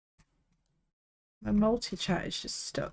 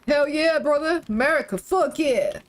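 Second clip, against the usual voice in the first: loud, higher hammed-up American accent; American cheer; 7 semitones higher, 5 dB louder than usual. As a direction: cheer like a cartoon patriot in a mock American accent. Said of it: "the need to put on a deep voice when I do it".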